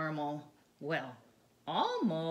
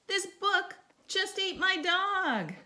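Bella's complaint; 11 semitones higher, 5 dB louder than usual. Acted. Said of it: "said Ben".

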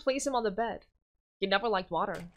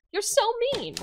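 put-on much higher, teasing child; giggly boy king; 12 semitones higher, 4 dB louder than usual. Yuri teasing Silver on her back, much higher teasing voice. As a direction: tease her playfully.

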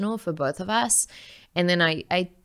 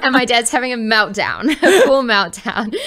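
laughing through the words, louder; cracking up while telling it; 8 semitones higher, 9 dB louder than usual. Real, not acted. Daughter laughing as she tells of dad's meltdown, laughing.

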